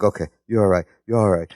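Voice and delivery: very low, hushed-pitch reassurance; mock soothing. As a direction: act out soothing a friend who choked. Retold soothing while patting face.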